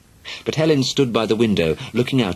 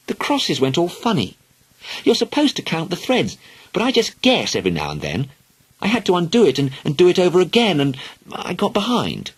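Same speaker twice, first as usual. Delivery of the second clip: put-on high, eager girl explaining; little girl voice; 11 semitones higher than usual. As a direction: chatter like a young girl. Helen voiced as a child.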